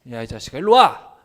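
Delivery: loud furious shout; angry yell. Pretend. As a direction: bark the order like an enraged master. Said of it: "기분 나쁘면?".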